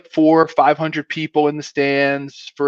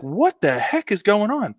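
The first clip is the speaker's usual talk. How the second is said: higher-pitched retold bafflement; baffled; 7 semitones higher than usual. Players hearing a crowd out of sight, retold bafflement. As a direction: act out puzzled disbelief.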